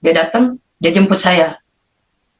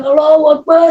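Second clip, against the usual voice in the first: acted-out yell for deliverance; re-enacted cry; 8 semitones higher than usual. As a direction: cry out high, stretching the vowels. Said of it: "Saya teriak-teriak".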